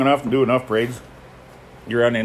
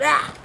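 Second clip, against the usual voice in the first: a short, much higher yelp of fright; startled yelp. Retold or imitated. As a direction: jump back with a sudden yelp. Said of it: "and I'm like".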